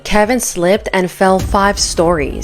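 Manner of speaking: punchy gossip show voiceover; tabloid host. Acted. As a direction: hype up the scandal like a tabloid host. Sensational gossip story read.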